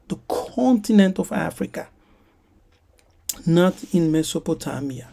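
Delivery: ordinary delivery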